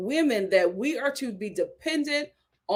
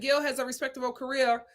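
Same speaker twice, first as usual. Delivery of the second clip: rushed, softer reading; talking too fast; 5 dB quieter, faster than usual. Real, not acted. Host reading a viewer's comment aloud, talking too fast. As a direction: rattle through it too quickly. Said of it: "I'm talking too fast".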